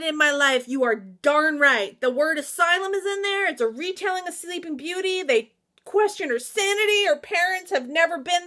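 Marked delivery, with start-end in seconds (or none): none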